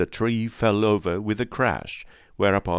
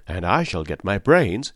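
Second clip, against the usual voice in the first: usual speaking voice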